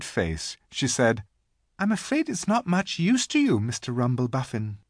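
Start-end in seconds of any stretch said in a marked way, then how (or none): 1.8-4.8 a polite, high little voice; apologetic girl. A narrator's reading. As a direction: gently apologise in a high girl's voice. Lucy speaks to the giant.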